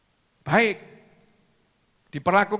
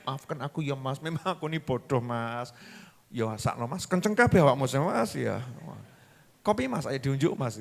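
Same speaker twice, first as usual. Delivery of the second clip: lower, softer humble plea; soft apology; 5 semitones lower, 5 dB quieter than usual. Acted. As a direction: beg forgiveness gently. Wife soothing angry husband.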